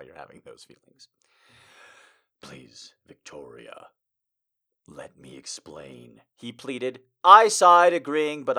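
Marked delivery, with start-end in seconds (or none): 2.4-6.2 low, soft plea; soft pleading lover. Acted. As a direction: beg gently to be heard. Male character pleading.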